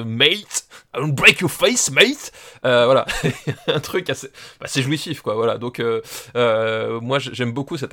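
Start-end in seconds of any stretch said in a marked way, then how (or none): 0.0-2.3 loud, high, hammed-up Cockney threat; Cockney accent. Fan quoting the actor's film line, loud imitated Cockney. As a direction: throw it out as a mock Cockney hard man. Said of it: "cette façon de".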